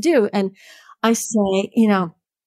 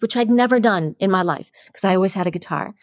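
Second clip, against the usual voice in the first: normal talking voice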